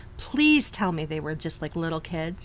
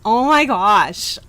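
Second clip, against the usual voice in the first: slow, louder dismayed outcry; 5 dB louder, slower than usual. Real, not acted. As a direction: cry out in sympathy, slowly. Reacting to guest's sad story.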